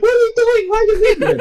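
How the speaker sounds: very high squawking mimicry; hammed-up indignant squeal; mock outrage. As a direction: imitate a frantic crowd protesting. Retold outraged group, mimicked.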